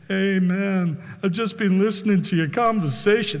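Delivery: badly faked American biker drawn into chat; terrible American accent. Big biker interrupting diners, bad American accent. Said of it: "That's terrible accent, forgive me".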